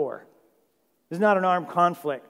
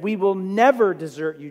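false bravado; 3 semitones higher, 8 dB louder than usual. Retold or imitated. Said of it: "shook their fists and puffed their chests".

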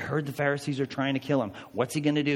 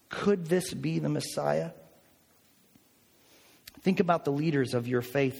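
normal talking voice

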